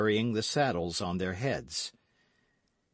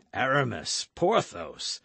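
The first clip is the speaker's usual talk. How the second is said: lightly raised cry, half laughing; cried out; 2 semitones higher than usual. A narrator's reading. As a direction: call the names out with a touch of amusement. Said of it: "cried Athos".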